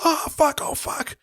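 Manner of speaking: high panicked oh fuck, fading to a breathless whisper; panic, then lose your voice. Retold or imitated. Husband panics as his wife screams, high then whispered. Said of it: "He goes".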